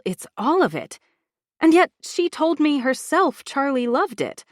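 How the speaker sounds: ordinary delivery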